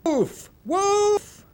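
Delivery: high yelled false alarm; loud cry of wolf. A narrator's reading. Boy calls villagers up the hill, high shouting. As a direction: shout it across the hillside. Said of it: "in his loudest voice cried".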